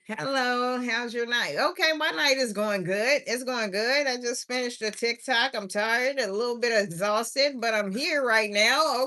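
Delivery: ordinary talk, nothing marked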